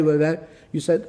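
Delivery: usual speaking voice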